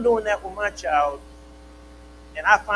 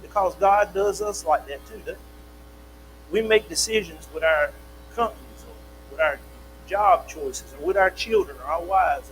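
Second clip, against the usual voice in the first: normal talking voice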